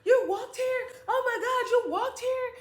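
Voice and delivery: high, loud disbelieving questions; stunned brother. Retold or imitated. Brother meeting the child who walked home, stunned. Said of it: "my brother is just like".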